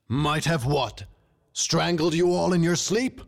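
high, incredulous kingly outburst; mock the guard's excuse, climbing to a high finish; scornful outrage. A narrator's reading. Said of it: "another one of the king's roars".

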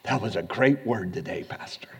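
hushed, breathy imitation of a fan; whispered mock compliment. Churchgoer flattering the pastor after service, whispered. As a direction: murmur it like a flatterer at the door.

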